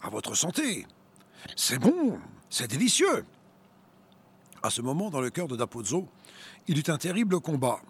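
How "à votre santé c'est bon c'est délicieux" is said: taunting. A narrator's reading. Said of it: "remarques provocantes".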